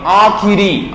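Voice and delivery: आखिरी with the short i fully sounded; correct i vowel. Shown as a demonstration. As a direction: clear "khi" in आखिरी. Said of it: "आखिरी नहीं".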